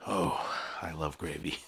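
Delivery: low, hushed comment to yourself; quiet aside. Real, not acted. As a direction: drop your voice right down for an aside.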